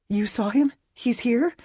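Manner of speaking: hopeful surprise. A narrator's reading. To Phil, hearing Aiden may be near, excited and hopeful. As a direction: jump at the news he might be here. Dana's sudden hope for Aiden.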